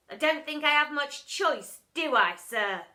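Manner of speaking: a hint of a delighted boy; cheeky boy. A narrator's reading. Boy happily munching the teacher's earwax. Bog voice.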